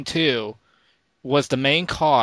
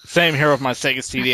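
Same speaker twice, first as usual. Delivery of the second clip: ordinary talk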